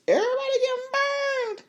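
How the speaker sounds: high, half-crying wail over the burning; mock wail. Recapping the show, a hammed-up wail. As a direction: fake a cry of dismay.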